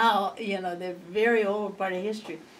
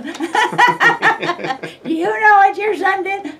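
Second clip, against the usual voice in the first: much higher, slow, squeezed through laughter; laughing mock complaint; 12 semitones higher, 16 dB louder, much slower than usual. Mother mimicking parents complaining about her son, laughing high. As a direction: play the outraged parent while cracking up.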